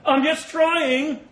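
slow, loud, high and deliberate; strained loud declaration. Acted. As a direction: grind the words out with effort. Soldier fixes bayonet, strains.

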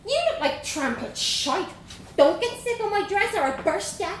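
put-on high, bold Dublin girl voice; young Dublin girl. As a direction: play a cheeky little Dublin girl. Vanessa scolds him.

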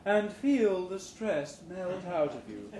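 slow, soothing, stressing feel; soothing trainer. Acted. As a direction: lull them into relaxing. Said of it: "the new hire trainer's voice says soothingly".

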